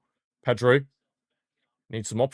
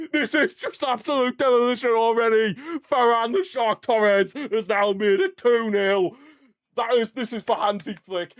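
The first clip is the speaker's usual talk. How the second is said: elated after goal; 10 semitones higher than usual. Real, not acted. Fan still buzzing after the second goal, elated. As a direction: ride the high of the goal.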